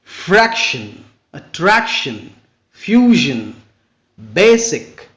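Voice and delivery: weight on the next-to-last syllable of fraction, attraction, fusion, basic; teacher's list, BA-sic and FRAC-tion stressed early. Shown as a demonstration. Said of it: "the stress usually falls on the next to last syllables".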